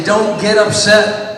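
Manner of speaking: slow, loud model line, punching upset; call to repeat. Shown as a demonstration. Speaker to students, slow loud model phrase to repeat. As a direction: say it slow and loud for the crowd to echo. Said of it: "Everybody repeat after me".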